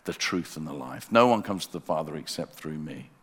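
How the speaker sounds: ordinary talk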